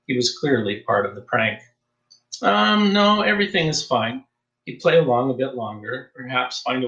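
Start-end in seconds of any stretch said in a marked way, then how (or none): 2.4-4.2 higher, hesitant cover-up, forced calm; nervous denial. A narrator's reading. Young man brushing off the priest's worry, nervous. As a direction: fake an easy answer with nerves underneath. Matt hides his unease from priest.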